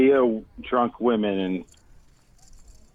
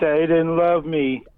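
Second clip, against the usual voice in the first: put-on whiny drunk woman; 6 semitones higher, slower than usual. Mocking drunk women's whining.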